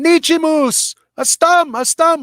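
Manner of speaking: high, loud calling out; mock scream. Husband calling his wife across the gathering, high loud. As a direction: holler it across the parking lot. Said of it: "he's going".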